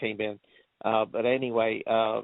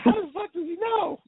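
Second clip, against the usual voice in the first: very high incredulous squeal of a question; high-pitched comic bafflement; retold disbelief. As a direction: replay your astonishment, pitch shooting way up. Retelling own disbelief at the film.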